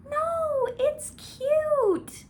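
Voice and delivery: sweet high Ji Lian teasing his embarrassed partner; lightly mocking Ji Lian voice. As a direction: coo it like a fond lover. Ji Lian voiced reassuring Hua Cheng.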